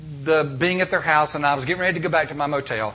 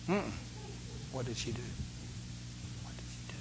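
hushed question; 5 semitones lower, 19 dB quieter, faster than usual. Retold or imitated. Mock dialogue, hushed reply.

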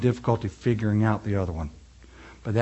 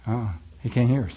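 ordinary talk, nothing marked

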